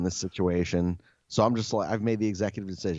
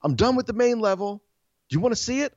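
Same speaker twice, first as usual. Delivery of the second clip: higher, louder call up the stairs; yelling up; 12 semitones higher, 5 dB louder, faster than usual. Retold or imitated. Cleaner yelling up to the homeowner upstairs, higher and louder. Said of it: "so she yells up".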